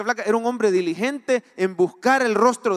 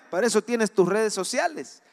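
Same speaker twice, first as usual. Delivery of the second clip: ordinary talk, nothing marked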